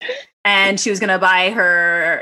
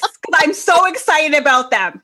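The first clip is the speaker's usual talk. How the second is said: louder and slowed down, a playful warning; mock warning; 6 semitones higher, 4 dB louder, slower than usual. Telling her husband to leave her slippers alone, a mock stern warning. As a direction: lay down the law, half joking.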